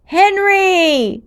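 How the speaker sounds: high, loud scream of a name. Acted. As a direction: scream his name as he falls. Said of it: "Natalie screamed".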